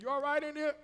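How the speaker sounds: wife calling. Acted. Wife checking on husband in the shower, calling loudly. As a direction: call out loudly to someone in another room. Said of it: "Your wife said".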